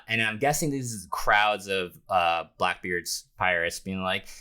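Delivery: ordinary talk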